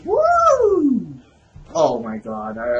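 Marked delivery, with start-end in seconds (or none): 0.0-1.2 long, high whoop. Real, not acted. Gamer whooping mid-game, excited. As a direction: let out a soaring "woo".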